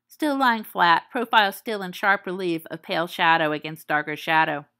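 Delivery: plain reading voice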